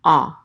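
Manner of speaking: A said as a rounded "aw", like A W; one round, drawn "aw" vowel. Shown as a demonstration. Teacher repeating the ancient kamatz sound, rounded aw. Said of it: "like A W".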